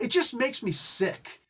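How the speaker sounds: high, slow, loud mock disgust; imitated screaming. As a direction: mimic an indignant girl's outburst. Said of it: "decided she wanted to keep on screaming".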